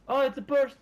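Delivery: high, loud startled cry; loud alarmed call; alarmed shout. Real, not acted. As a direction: shout a sudden warning. Spots someone in game.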